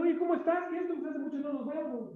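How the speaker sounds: put-on high, friendly call to a shy boy; gentle coaxing. Teacher greeting a frightened pupil, high and friendly. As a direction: coax the scared kid closer.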